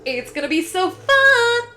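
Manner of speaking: high, loud burst of excitement; bursting with excitement for the next video; excited. Real, not acted. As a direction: sell the next video with glee.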